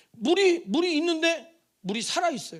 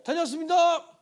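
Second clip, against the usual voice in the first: higher, louder call at the door; child's homecoming greeting; 5 semitones higher, 5 dB louder than usual. Retold or imitated. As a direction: imitate a child announcing they are home. Child calling out on arriving home. (집에 온 아이의 높고 큰 인사 흉내)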